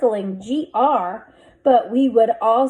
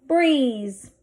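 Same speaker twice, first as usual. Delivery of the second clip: usual speaking voice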